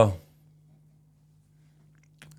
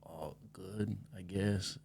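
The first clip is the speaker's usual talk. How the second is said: half-hearted good; 11 dB quieter, much slower than usual. Retold or imitated. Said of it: "And they go".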